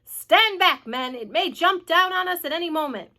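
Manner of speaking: loud warning, easing slightly; alarmed cry. A narrator's reading. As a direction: call the men back urgently. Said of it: "cried the chief of police".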